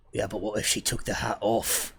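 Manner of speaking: lightly voiced street thug; a touch of henchman, reasoning it out; mild thug character voice; other thug. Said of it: "And the other one goes".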